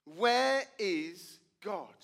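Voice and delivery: slow, drawn-out searching question; stern pastor. Retold or imitated. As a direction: lean in and ask it very slowly. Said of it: "he says to the boy".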